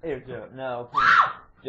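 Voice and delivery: shouting at dog. Real, not acted. Calling the dog off.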